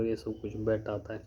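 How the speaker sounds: ordinary talk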